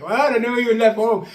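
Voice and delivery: high, loud disbelief; blurting out in shock; startled squeal at the friend's words. Retold or imitated. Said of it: "I was like".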